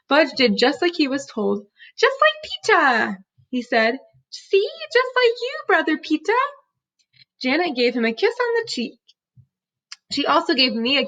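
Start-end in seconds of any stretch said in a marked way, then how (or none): 2.0-3.2 put-on proud toddler. Toddler Fudge proud of riding. 4.3-6.3 put-on much higher, a little kid showing off; proud toddler. Toddler Fudge boasts to brother.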